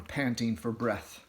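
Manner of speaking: ordinary delivery, nothing marked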